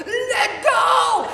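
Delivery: sudden high full-voiced yell; screaming. Woman yelling at herself to let go, high loud scream. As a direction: explode at yourself to let go. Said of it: "And then I was screaming".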